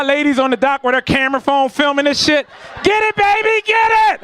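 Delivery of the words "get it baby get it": high-pitched, excited cheering voice; cheering girlfriend. Retold or imitated. Lady filming on the dock, high cheering. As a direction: egg him on in a high voice.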